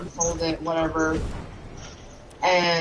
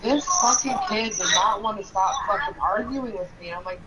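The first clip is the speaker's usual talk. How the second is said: normal talking voice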